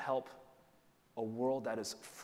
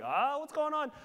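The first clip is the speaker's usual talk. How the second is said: higher, louder, a mock panicked cry from the boat's wood; panicking boat; 6 dB louder than usual. Preacher voicing the creaking boat, mock panic. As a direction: squeal the boat's alarm.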